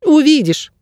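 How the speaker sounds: high, cryptic little bird; squeaky bird guide. A narrator's reading. Bird guide leaving his ward guessing, high squeak. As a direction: squeak it mysteriously. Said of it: "сказал Птах".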